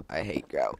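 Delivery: low, grumbling take. Acted. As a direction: drop the voice down low. Repeats line in varied voices.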